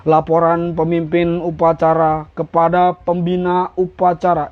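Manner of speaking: slow, sonorous formal report; pemimpin upacara. Shown as a demonstration. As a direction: recite it grandly and wordily, evenly paced. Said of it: "suaranya udah keren banget". (laporan resmi, pelan dan berwibawa)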